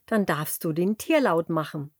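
ordinary delivery

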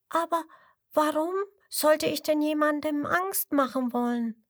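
high squeaky wondering voice; baby crocodile's high question; little crocodile asking. A narrator's reading. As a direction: ask innocently in a tiny voice. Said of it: "fragt das kleine Krokodil".

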